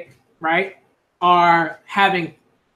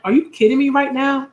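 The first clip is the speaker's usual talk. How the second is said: high, incredulous "are you kidding me"; retold disbelief; 7 semitones higher than usual. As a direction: recall the shock of reading it. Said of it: "I was like".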